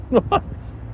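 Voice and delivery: high, breathy cartoon crab laugh; wheezy crab laugh, tongue in cheek; Mr. Krabs laugh. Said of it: "Do you do a good Mister Crab impression? 'Cause I do".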